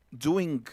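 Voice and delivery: doing with a hard g at the end. Shown as a demonstration. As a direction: end doing on a clear g sound. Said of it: "No vamos a decir". (doing con g final marcada)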